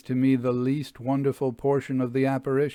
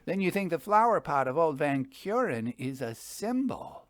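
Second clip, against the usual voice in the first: higher voice for Jasper; Jasper, curious; 5 semitones higher than usual. A narrator's reading. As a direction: ask whether it's a symbol. Jasper asks.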